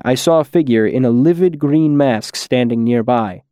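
ordinary delivery, nothing marked

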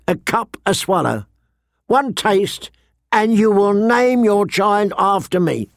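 high-pitched, flourishing sales pitch; wheedling wine merchant. Acted. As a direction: sell it grandly, lingering over each flavour.

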